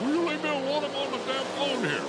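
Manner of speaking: brash New York wife snapping back; New York accent. Retold or imitated. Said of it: "in the best New York accent".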